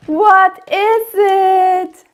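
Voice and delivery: slow, high, wondering question; amazed question. Acted. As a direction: ask it full of wonder. Wonder after the gasp.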